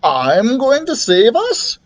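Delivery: slow, high-pitched disbelief; incredulous dragon voice; astonished. A narrator's reading. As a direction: drag out the question, rising at the end.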